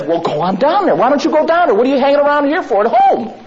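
high, loud, fast taunting; a brother jeering, go on down there; scoffing brother. Retold or imitated. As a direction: needle him like a mocking sibling. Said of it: "James says".

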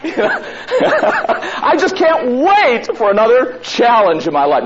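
high, loud, gushing with laughter; hammed-up delight over a new challenge; mock overjoyed. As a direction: play it giddy and thrilled at trouble. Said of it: "Notice the word challenge".

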